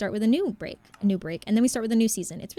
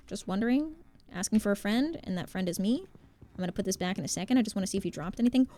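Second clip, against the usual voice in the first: usual speaking voice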